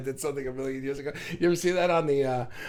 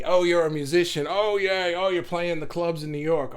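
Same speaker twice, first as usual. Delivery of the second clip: raised, louder voice of polite society's approval; mock impressed; 4 semitones higher, 5 dB louder than usual. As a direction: play an impressed socialite, lightly mocking. Acting out accepting polite society.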